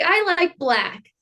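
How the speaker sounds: lightly voiced kid stating a preference; imitating young daughter. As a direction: quote the child slowly, pitched well up. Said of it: "She's like".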